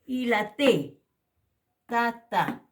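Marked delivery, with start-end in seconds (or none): 1.8-2.6 "tata" said loudly with a Spanish-style t; plain t model. Shown as a demonstration. Teacher modelling the plain t. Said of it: "como en el castellano".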